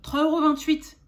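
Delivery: loud, high announcement of the price; big emphatic price reveal; triumphant reveal. Real, not acted. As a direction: proclaim the bargain price proudly. Proud reveal of tiny receipt total.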